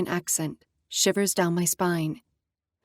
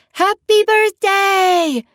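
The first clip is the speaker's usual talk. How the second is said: much higher, much louder, drawn-out cheer; group shout; 12 semitones higher, 9 dB louder, much slower than usual. Acted. Friends in the limo yelling to the birthday girl, loud slow shout. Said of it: "everyone shouts".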